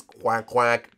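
low mock quacking penguin. Penguin quacks to friend, low mock quack. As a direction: voice Gunter answering with quacks.

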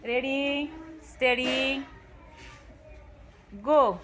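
slow, drawn-out starter's call; countdown. Real, not acted. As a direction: stretch each word like a race start. Starting third time.

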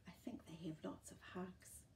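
hushed whisper shared with little listeners. Acted. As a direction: lean in and whisper it like a secret. Reading a picture book to children.

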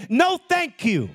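high, slow emphatic no thank you; firm refusal. Shown as a demonstration. Modeling refusal to temptation.

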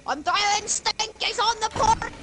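excited callout. Real, not acted. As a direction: crow it over the battle. Shouting game callout mid-battle.

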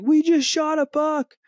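high-pitched ecstatic squeal, slow and drawn out; squealing with joy. Retold or imitated. Boy squealing to his dad after the shot, ecstatic shriek. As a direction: shriek it like a kid who just got his first buck. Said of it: "He's like".

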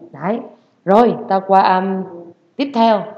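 normal talking voice

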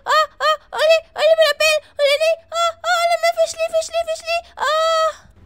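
squealing alarm as she falls; slipping scream. Acted. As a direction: yelp and flail as your foot goes out. Sonam slips in cow dung.

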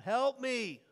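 loud, high cry of pain, done by the preacher; anguished cry for help. Retold or imitated. Preacher voicing the rich man crying from hell, loud anguished cry. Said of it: "he just cries out".

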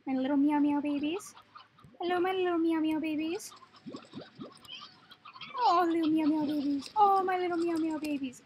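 high, sing-song cooing. Real, not acted. Owner greeting little meow meow babies, doting baby talk. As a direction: gush over tiny pets in a high coo. Baby-talking the cat slime pets.

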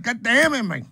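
hammed-up buyer rage; mock frustrated. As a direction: whine at every site saying no. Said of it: "And then you're like".

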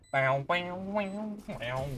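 bouncy twangy "bow" noises; imitated twang. Streamer amusing themselves mid-game, imitated twang.

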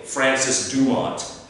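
"Dumont" slowly as DOO-mont, hard final t; anglicised pronunciation. Acted. Listener trying out "Dumont", slow English pronunciation. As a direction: sound every letter of "Dumont" the English way. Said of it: "to call himself Dumont".

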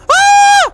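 loud, piercing falsetto scream; high scream. Acted. Girlfriend attacked at the mirror, high loud scream.

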